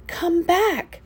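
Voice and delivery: a lightly voiced high call; calling frogs. A narrator's reading. Frog friends calling after the carried-off frog, lightly. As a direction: call out gently from far away. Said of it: "my friends calling to me".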